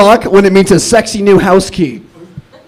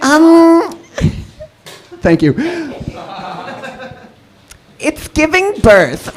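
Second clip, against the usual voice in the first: Gen Z nurse; 7 semitones higher, 6 dB quieter, slower than usual. Acted. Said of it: "my impression of a Gen Z nurse".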